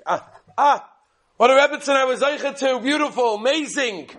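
high, loud exclamations of praise; delighted admiration. Retold or imitated. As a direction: marvel aloud like a proud husband. Husband marvels at his wife's kindness.

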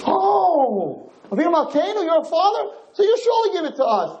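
high-pitched, over-excited imitation of petitioners; mock eager supplication. People realizing God is their father, mock high excited begging. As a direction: exclaim it very high, hammed-up.

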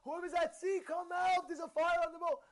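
high-pitched call of alarm; imitated screaming. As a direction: call out as if to everyone aboard. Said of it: "He's screaming".

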